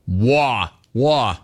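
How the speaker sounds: mock New Yorker's dropped r; imitating New York accent. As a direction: mimic a caller's New York vowels. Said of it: "Bro, are you from New York?".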